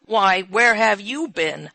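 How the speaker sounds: slow, loud questioning; loud demand. A narrator's reading. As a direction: demand where they've been. Old man demanding answers.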